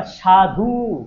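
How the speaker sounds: "সাধু" stretched on its closing U; final U held. Shown as a demonstration. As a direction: draw out the end of "সাধু" to show the U. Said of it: "উটা কোথায় উচ্চারণ হচ্ছিল". ("সাধু"-র শেষের উ লম্বা করে)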